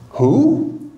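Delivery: confused who. Acted. Preacher voicing confused listener.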